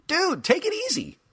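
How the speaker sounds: high, loud irritated figure; cranky toy voice. Acted. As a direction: gripe sharply.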